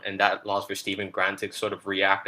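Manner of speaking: ordinary delivery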